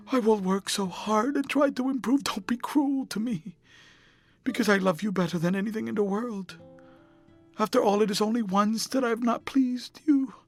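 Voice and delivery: high, desperate begging near sobs; passionate pleading. A narrator's reading. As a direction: plead with the lover not to leave, voice pitched high. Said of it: "A fit of passionate sobbing choked her".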